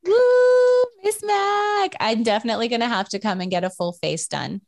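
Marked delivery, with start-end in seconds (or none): 0.0-1.9 high excited whoop. Real, not acted. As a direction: cheer the name, pitched way up. Excited cheer for the brand.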